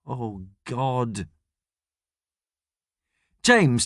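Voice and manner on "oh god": soft mutter into the hands; quiet, mortified groan of words; muttered dismay. A narrator's reading. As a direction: mutter it low in embarrassment. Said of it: "and mutters".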